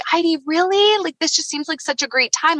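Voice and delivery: high, lightly imitated pleading; coaxing. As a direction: act out a husband's gentle persuading. Husband pleading to share news.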